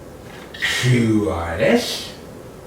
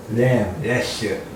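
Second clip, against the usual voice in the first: ordinary delivery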